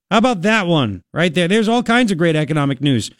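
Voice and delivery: normal talking voice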